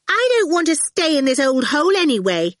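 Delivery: put-on high, loud, rude child; rudely. A narrator's reading. Awful scorns Dillion's house on leaving, rudely. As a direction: sulk loudly in a high child's voice. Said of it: "Awful said rudely".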